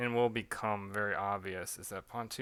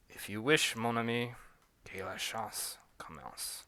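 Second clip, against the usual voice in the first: put-on French accent; 3 dB quieter than usual. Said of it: "a British and French accent".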